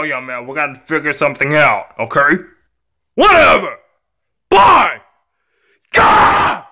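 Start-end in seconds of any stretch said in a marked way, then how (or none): 3.2-6.6 put-on high, defiant kid yell; tantrum shouting. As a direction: yell each word, ending in a scream. Child throws tantrum at parent.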